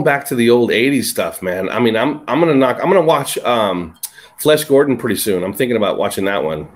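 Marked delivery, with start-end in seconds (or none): none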